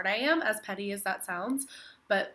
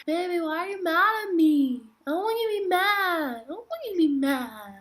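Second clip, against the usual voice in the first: put-on much higher, louder whiny baby voice; baby talk; 8 semitones higher, 6 dB louder than usual. Shown as a demonstration. Girl baby-talking to her boyfriend, high and whiny. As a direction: coo it like a pouting toddler. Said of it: "I'll do baby talk".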